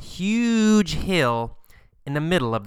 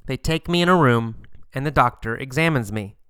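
ordinary delivery

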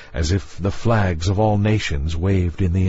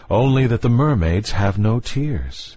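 ordinary delivery, nothing marked

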